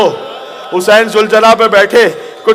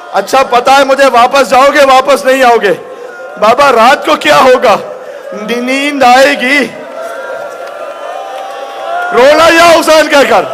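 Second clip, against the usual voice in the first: impassioned majlis preaching; 5 semitones higher than usual. Acted. Mourning recitation.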